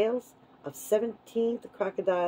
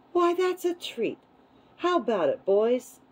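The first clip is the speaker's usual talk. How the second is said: high, eager call to pals; excited crocodile; 6 semitones higher than usual. A narrator's reading. Crocodile calling the boys over for a treat, excited.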